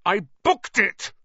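slow, high, loud fury; enraged, emphatic shout; spitting with rage. A narrator's reading. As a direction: drive each word out furiously. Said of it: "positively spitting with rage".

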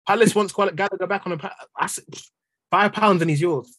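normal talking voice

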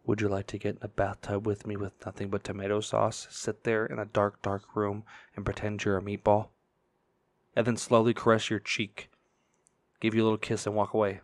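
low, creepy murmur right on the mic; creepy whisper. Acted. Creep stopping a stranger on the street, low creepy murmur. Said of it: "stop them, and whisper".

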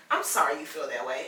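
mock dismissive. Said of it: "and they say".

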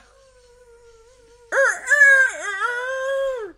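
loud wheezing laughing fit; cracking up hard; coughing laugh. Real, not acted. Laughing fit after a joke.

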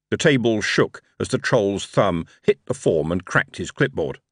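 ordinary delivery, nothing marked

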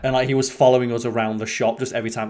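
ordinary talk

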